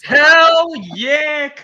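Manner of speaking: high, loud hype shout; excited cheer. Real, not acted. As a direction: whoop it out. Cheer at the shaved head.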